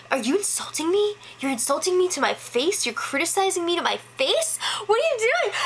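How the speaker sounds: Vanessa. Retold or imitated. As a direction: imitate someone indignant and falling apart. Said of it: "And she freaks out".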